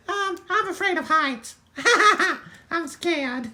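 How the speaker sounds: high-pitched mock whimper; scared little voice. Yodi afraid of heights, high scared voice. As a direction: squeak it in pretend fear.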